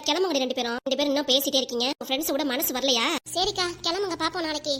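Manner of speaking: normal talking voice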